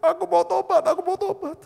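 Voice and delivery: high-pitched crying plea; retold sobbing. Husband hugging his wife in tears, mock sobbing plea. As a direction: act a man wailing his repentance. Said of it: "sambil nangis".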